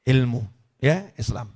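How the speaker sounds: ordinary talk, nothing marked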